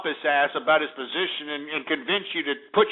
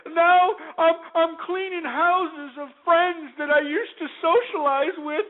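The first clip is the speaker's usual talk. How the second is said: much higher, slow, whimpering mock sob; mock crying; 11 semitones higher than usual. Mocking a crying Madoff victim on TV, hammed-up sobbing. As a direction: ham up a sobbing rich woman. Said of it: "she was sitting there crying".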